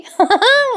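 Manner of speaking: short high burst of laughter. Real, not acted. Narrator cracks up at the quarrel, high laugh.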